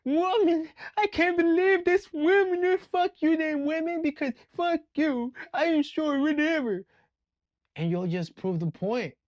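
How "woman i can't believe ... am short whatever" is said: high, loud, slow mocking whine of a short man; mock an offended guy yelling at women; whiny angry man. Mocking short men ranting at women, high loud whine. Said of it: "And you guys are like".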